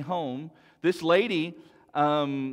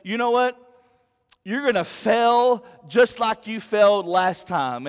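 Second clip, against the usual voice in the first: taunting enemy voice; 5 semitones higher, 6 dB louder than usual. Acted. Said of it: "when he comes at you and says".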